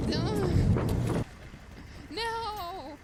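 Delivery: high, loud cry of no; dismayed shout. Real, not acted. Player hit by sudden attack, dismayed shout.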